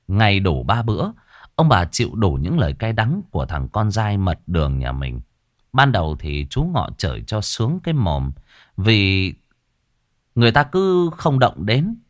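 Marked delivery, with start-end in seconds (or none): none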